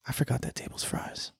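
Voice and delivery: whispered to himself; dismayed whisper. Retold or imitated. Server on his way home realizing the forgotten fries, whispered. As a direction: breathe it out under your breath.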